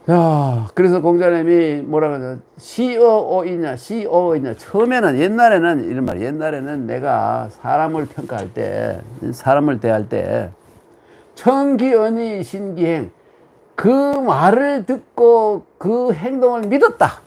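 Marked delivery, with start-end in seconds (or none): 2.6-4.5 slow drawn-out classical quote; solemn quote. Acted. Lecturer intones Confucius's words slowly. 11.4-17.2 slow, high classical recitation; solemn recitation. Acted. Lecturer recites the classical proverb slowly and high.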